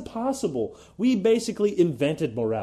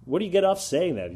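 ordinary talk, nothing marked

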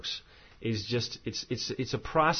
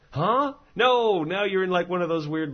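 incredulous huh; 7 semitones higher, 4 dB louder than usual. Real, not acted. Exasperated loop of argument.

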